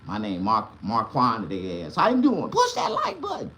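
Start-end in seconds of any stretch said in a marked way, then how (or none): 2.0-3.5 very high laughing chirp; high giddy like button plea; sing-song greeting. Pretend. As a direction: chirp it like a silly hello.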